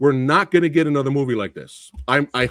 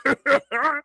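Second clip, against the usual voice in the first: incredulous laugh; 10 semitones higher than usual. Real, not acted. Laughing at the dumb line.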